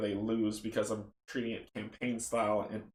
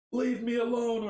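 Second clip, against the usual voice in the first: high, loud protest; agitated outburst; 11 semitones higher, 6 dB louder than usual. Acted. Arthur to the taunting hands, agitated outburst. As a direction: lash out in a raised voice.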